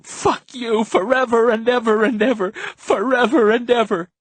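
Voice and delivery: high, loud wailing; anguished high-pitched tantrum; crying. A narrator's reading. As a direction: cry it out at full voice. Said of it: "He was crying".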